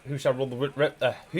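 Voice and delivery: usual speaking voice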